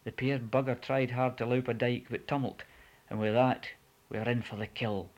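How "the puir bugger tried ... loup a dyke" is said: ordinary delivery, nothing marked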